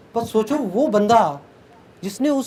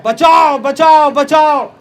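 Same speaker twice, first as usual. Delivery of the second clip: put-on loud, high drowning child's cries; screaming for help; 7 semitones higher, 9 dB louder, much slower than usual. Drowning child cries out for rescue, screaming for help. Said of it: "चिल्ला रहा है".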